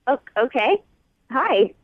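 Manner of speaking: ordinary delivery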